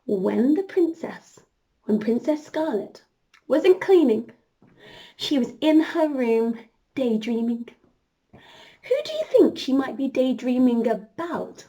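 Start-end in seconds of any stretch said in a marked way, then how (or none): none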